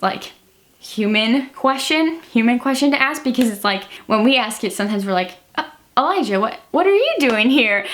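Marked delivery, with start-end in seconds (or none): 5.6-7.8 high-pitched, stumbling surprise at Elijah; mock incredulous. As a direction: act thrown to find someone here, voice jumping up. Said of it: "not asking it like that".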